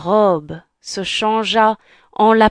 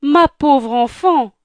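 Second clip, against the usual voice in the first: stepmother voice; 7 semitones higher, 5 dB louder than usual. Acted.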